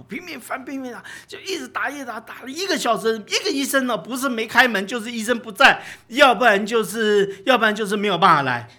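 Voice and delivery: high, urgent frantic recounting; retold panic. Preacher retelling a worried father phoning every doctor, frantic and mounting. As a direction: build from hurried high pitch to loud exasperation.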